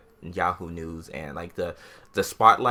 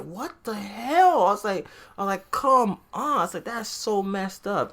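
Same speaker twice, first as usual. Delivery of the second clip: retold dismay; 10 semitones higher than usual. Reacting after the news broke, high-pitched dismay. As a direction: replay an incredulous protest in a raised voice.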